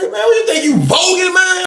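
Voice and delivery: high-pitched challenging call; mock confrontation. Teller mimics a man being challenged at the door, high mock confrontation. Confronting someone in a retold encounter.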